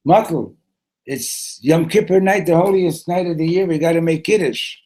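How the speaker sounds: loud, high-pitched insistence; urgent urging. Acted. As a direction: press a sleepy friend to get up. Waking a friend for Kiddush.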